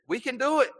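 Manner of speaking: high, bright mock boast of "We can do it"; mock-eager high boast. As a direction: play the eager disciples, pitch way up. Mocking the disciples' boast.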